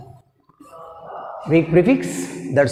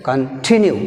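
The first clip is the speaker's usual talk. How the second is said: "Continue" with TIN hit hard; weak Con, then a punched TIN in "Continue"; loud second syllable; much slower than usual. Shown as a demonstration. Teacher proving why it must be firm, "Continue" stressed on TIN. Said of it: "మనం దాన్ని గట్టిగా పలకాలి".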